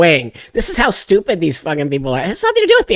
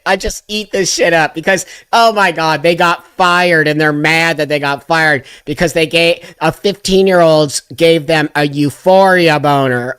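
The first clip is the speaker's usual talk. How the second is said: ordinary talk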